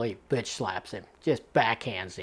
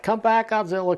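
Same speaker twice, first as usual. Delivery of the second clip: mock crying plea; 9 semitones higher, 5 dB louder than usual. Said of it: "Poor [name] is crying".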